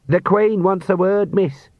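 put-on high, slow nervous page boy; a flustered young servant announcing. A narrator's reading. Young servant Sean voiced.

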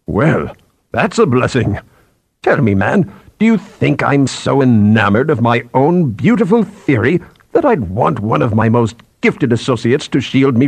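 indignant, authoritative reprimand; furious senior scientist. Acted. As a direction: dress down a subordinate point by point.

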